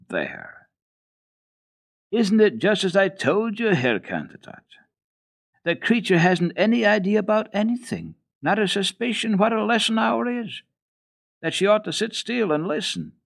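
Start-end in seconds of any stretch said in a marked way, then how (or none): 2.1-13.1 stern governess. Acted. Rottenmeier scolding about Heidi.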